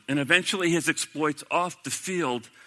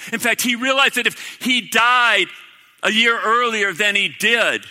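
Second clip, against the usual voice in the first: louder, higher, driving sermon voice; preaching at full force; 7 semitones higher, 7 dB louder than usual. Acted. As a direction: preach it out, rising with conviction.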